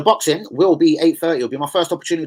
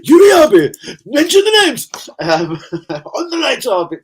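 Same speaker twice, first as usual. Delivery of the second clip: much higher and louder, shouted through laughter; playful call-out; 11 semitones higher, 7 dB louder, slower than usual. Real, not acted. Vlogger calling out Yuri Abe, teasing loud shout. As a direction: yell the friend's name in teasing glee.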